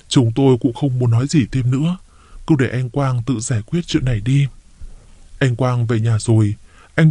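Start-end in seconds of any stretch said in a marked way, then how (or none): none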